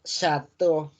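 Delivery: "chateau" slowly, as sha-TO; French pronunciation. Shown as a demonstration. Teacher presenting a French word, slow "chateau" ending in O. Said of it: "hacen una O".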